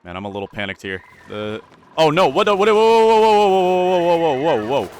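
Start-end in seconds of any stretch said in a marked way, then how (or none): 2.0-4.9 alarmed whoa. Real, not acted. Colony collapsing on screen.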